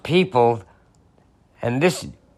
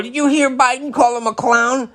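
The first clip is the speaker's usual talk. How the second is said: much higher mock whiny voice; mock outraged supporter; 10 semitones higher than usual. Mocking people outraged at Biden, high whine. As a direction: squeal the complaint. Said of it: "and then people go".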